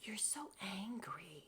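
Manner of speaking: hushed whispered impression; Retta. Commenting on how angry Robin is, whispered impression. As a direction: whisper it, barely any voice. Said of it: "I feel like Retta".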